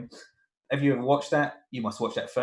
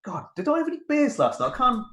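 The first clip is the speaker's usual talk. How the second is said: much higher, bewildered and hungover; groggy disbelief; 11 semitones higher than usual. Retold or imitated. Tired dad confused by the Welsh cartoon, high bewildered. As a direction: sound confused, like the morning after beers. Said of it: "I'm like".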